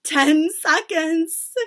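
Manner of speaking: very slow, loud countdown; high, drawn-out proclamation; witch crying out. A narrator's reading. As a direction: stretch it out with menace. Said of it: "cried the Grand High Witch".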